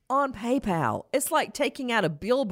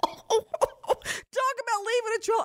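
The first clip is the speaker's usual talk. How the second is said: squeaky, drawn-out laughter over the words; laughing hard; slower than usual. Real, not acted. Host mocking the suspect's PayPal trail, laughing. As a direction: crack up helplessly while talking, very high.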